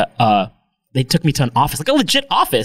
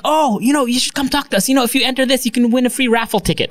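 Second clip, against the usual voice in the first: higher, eager pitch rushing at the end; mock salesperson; 10 semitones higher than usual. Sales staff luring him with a raffle, eager mock pitch. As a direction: sell him the raffle, hammed up.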